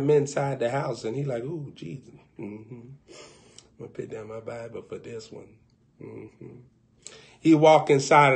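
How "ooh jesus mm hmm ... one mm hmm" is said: much softer, low murmur; hushed aside. Acted. Man whispering to himself before going inside, hushed. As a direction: sneak it under your breath.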